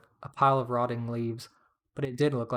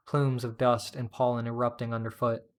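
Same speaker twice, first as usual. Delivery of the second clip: usual speaking voice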